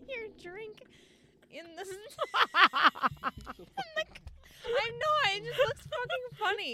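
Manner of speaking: high, loud giggling words; can't get it out for laughing. Real, not acted. Giggle fit over a drink.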